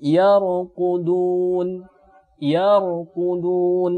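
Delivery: very slow yarqudun with a full, heavy ra; thick ra. Shown as a demonstration. Teacher reciting yarqudun slowly for students: thick ra. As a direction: stretch yarqudun, ra filling the mouth. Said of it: "dibaca dengan tebal".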